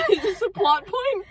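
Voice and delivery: high question through laughter; incredulous laughter. Real, not acted. Reader baffled by the plot, incredulous laughter. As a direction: ask it in giggling disbelief.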